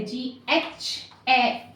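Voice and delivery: जीएच ए stretched very slowly, sound by sound; teacher drawing out each letter's sound; slow letter demo. Shown as a demonstration. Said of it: "ये sound involved है".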